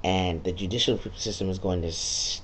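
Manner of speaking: normal talking voice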